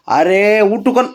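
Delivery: high, drawn-out mock complaint; exasperated protest. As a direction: protest loudly at an impatient pet. Protesting at dog's barking.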